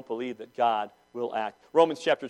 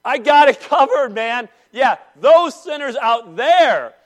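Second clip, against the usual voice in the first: loud, high mock of self-righteous smugness; smug churchgoer; 8 semitones higher, 13 dB louder, slower than usual. Preacher mocking smug believers, loud and brash. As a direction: lampoon the arrogant saved one, louder and higher. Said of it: "we act as if".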